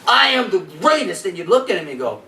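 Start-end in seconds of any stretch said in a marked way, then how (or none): 0.1-1.2 boxing champion's boast, "I" punched higher; Muhammad Ali boast. Retold or imitated. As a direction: proclaim it like a champion daring anyone. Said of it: "He said".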